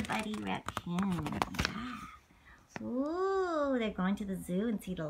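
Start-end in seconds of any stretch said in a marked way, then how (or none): 1.6-3.8 breathy whispered yeah and ooh. Real, not acted. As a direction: hush as if not to wake the stars. Hushed reaction to stargazing page.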